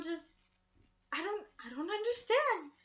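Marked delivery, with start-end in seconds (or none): 1.1-2.7 high, loud baffled cry; high pleading confusion; confused wail. Retold or imitated. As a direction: wail it out in disbelief. Retelling her confused upset reaction.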